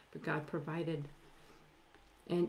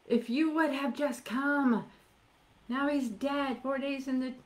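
reproachful lament; 7 semitones higher, 4 dB louder than usual. Acted. Grieving sisters reproach Jesus.

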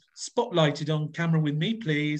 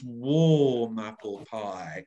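slow, warm and resonant; 5 semitones lower, much slower than usual. Shown as a demonstration. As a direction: linger on warm like a cosy kitchen. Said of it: "We have the warm qualities in the voice".

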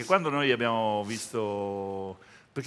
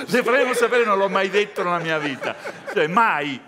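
laughing protest; 7 semitones higher, 7 dB louder than usual. Real, not acted. Host protests the imitation, laughing.

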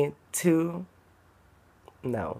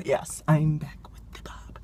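hushed whisper, slow at first; 7 dB quieter, slower than usual. Real, not acted. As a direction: whisper so nobody in the shop hears. Whispering in a store.

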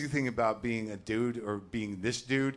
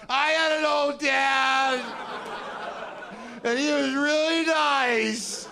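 much higher, louder, slow sobbing shout; mock crying wail; 7 dB louder, much slower than usual. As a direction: wail it like a tearful fan.

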